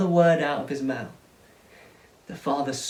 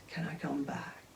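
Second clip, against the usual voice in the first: pleading whisper; 12 dB quieter than usual. Acted. Pleading child to father.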